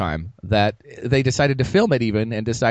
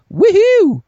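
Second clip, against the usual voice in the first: loud, high whoop of celebration; excited cheer; 9 dB louder than usual. Real, not acted. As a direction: cheer it out big. Cheering the network announcement.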